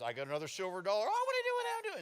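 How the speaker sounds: very high, excited squeal; mock child excitement. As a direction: play a giddy little kid. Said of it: "My dad's".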